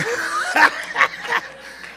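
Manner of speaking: high, breathy laugh; wheezy giggling fit; high airy laugh. Real, not acted. Teasing a congregant.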